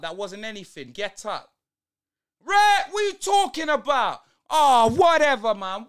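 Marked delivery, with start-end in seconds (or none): 2.4-5.5 loud, high, incredulous yell at the telly; angry outburst. Real, not acted. Fan yelling at his team on TV, loud and angry. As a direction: blast it at the screen in disbelief.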